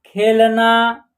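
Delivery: खेलना said slowly with each syllable drawn out; खेलना stretched slowly; slow word blending. Shown as a demonstration. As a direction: sound out खेलना for the class. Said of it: "न में आ की मात्रा ना".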